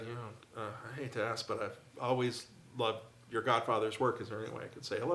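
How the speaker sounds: nervous fan quietly asking the godson for an introduction; hushed polite request. Retold or imitated. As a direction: ask timidly, keeping it quiet at a party. Timidly asking a favour.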